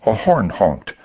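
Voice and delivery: ordinary delivery, nothing marked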